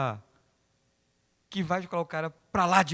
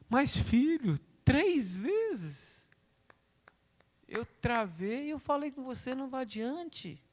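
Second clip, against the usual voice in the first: a hint of God gently reproaching, higher; God answering; 9 semitones higher, slower than usual. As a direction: remind the son patiently. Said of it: "Deus fala".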